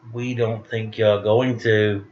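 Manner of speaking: usual speaking voice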